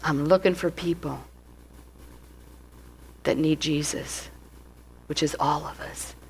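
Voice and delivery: quiet sincere aside; soft. Retold or imitated. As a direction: drop to a gentle hush. Soft point about needing Jesus.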